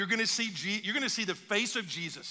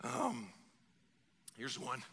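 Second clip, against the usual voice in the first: normal talking voice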